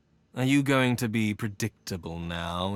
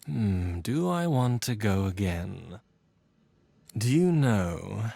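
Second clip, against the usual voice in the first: ordinary delivery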